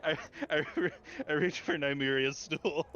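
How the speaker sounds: high, giggly, stumbling through the words; laughing. Real, not acted. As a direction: break up laughing while announcing your move. Laughing while announcing own move.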